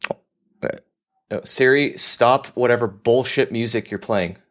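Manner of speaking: normal talking voice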